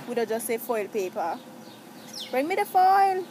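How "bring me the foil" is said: high, loud acted call for something; mock demand, pitched up and loud. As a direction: holler it out as if across the house. Acts out an everyday demand.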